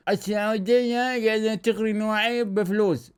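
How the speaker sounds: a voice unlike his own for a foreign pundit; mocking foreign pundit. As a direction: sneer it like a smug European commentator. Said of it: "هذول الاجانب". (تقليد ساخر لمعلق أجنبي)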